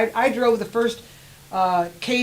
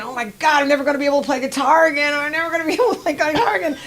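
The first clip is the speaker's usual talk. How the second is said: retold panic; 6 semitones higher, 3 dB louder, faster than usual. Retold panicked thought, guitar fear.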